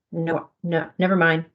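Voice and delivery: ordinary delivery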